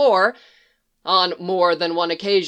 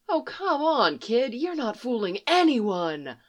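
softer, knowing taunt; teasing; 4 semitones higher, 4 dB quieter than usual. Acted. Roach teases the boy she sees through, softly mocking. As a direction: call his bluff gently.

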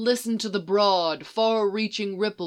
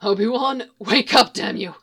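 loud, higher panicked yell; desperate shout; 4 semitones higher, 6 dB louder than usual. A narrator's reading. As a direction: shout him awake in panic. Panicked shout to unconscious partner.

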